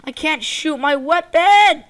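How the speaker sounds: loud, high-pitched complaint; frustrated shout. Real, not acted. Gamer about to die from a glitch, frustrated shout. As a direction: shout in helpless exasperation. Glitch stops him shooting.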